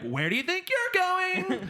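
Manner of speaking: very high, loud, drawn-out challenge; flamboyant troll. Acted. Troll as sitcom dean stopping the couple, flamboyant high cry. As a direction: flamboyant squeal, stretched to the end. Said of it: "yeah, very flammable".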